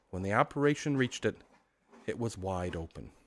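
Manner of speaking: usual speaking voice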